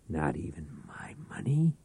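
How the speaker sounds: soft, breathy whisper; hushed, sly offer. Acted. Reich quietly tempts the pawnbroker, in a whisper. Reich replies softly, unvoiced.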